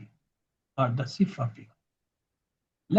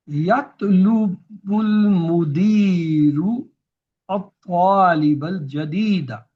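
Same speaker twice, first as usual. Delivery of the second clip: louder and slow, each Arabic word spelled out; slow, careful example; 2 semitones higher, 5 dB louder, slower than usual. Shown as a demonstration. Teacher reading an Arabic example sentence to students, slow and loud. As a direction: read the sentence slowly and clearly for the class. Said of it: "Let's have another example".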